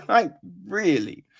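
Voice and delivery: ordinary talk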